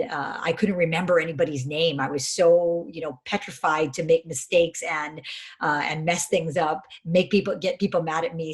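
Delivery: ordinary talk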